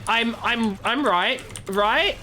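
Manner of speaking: mock a lawyer unsure he may say it; awkward, seeking permission. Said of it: "he was asking for permission".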